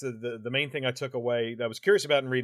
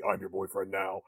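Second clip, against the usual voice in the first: low, quieter creepy quote; villain's phone line; 6 semitones lower, 6 dB quieter than usual. Retold or imitated. Host quoting the caller to Nancy, low creepy voice. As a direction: drop the pitch for a lightly voiced menace.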